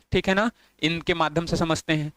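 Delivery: usual speaking voice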